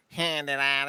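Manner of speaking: mock nerdy sitcom whine; Urkel. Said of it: "Urkel does his dumbass".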